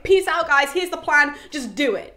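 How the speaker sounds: acting out an excited exit. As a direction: toss it out with eager excitement. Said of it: "she gets so excited".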